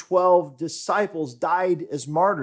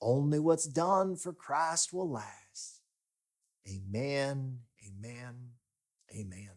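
put-on a light Southern drawl for an old pastor, slow on the rhyme; lightly voiced old Southern pastor, fading into soft amens; quoting his mentor's rhyme in a hint of Southern accent; Southern preacher voice; 3 dB quieter, slower than usual. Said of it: "his old Southern voice justice".

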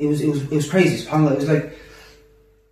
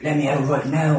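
ordinary delivery

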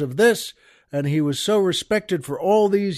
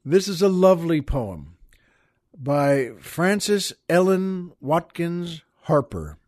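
ordinary talk, nothing marked

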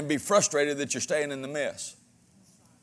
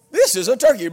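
toy voice; 5 semitones higher, 7 dB louder than usual. Retold or imitated. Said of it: "and it would say".

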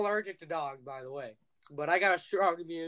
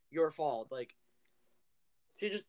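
ordinary talk

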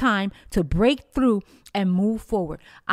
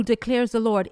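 ordinary talk

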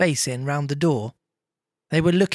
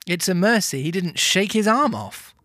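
ordinary delivery, nothing marked

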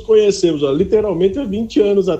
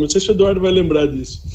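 usual speaking voice

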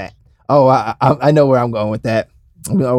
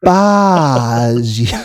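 garbage stretched out à la française; very drawn-out French accent; mock French accent. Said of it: "roll it off his tongue like a French guy".

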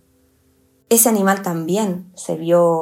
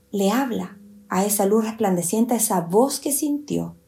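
ordinary delivery

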